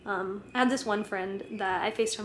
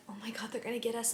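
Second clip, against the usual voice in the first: whisper; 7 dB quieter, faster than usual. Retold or imitated. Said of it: "and I'm like".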